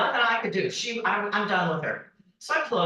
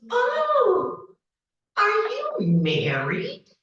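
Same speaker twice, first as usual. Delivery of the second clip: much higher, slow put-on voice for the stranger; imitating the woman; much slower than usual. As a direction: pitch way up and drag it out like the nosy seatmate. Woman on the train, retold quote.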